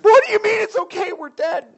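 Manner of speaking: a terrified servant squawking back; panicked disbelief. Retold or imitated. Servant to the prophet, frantic and terrified. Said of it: "And he's like".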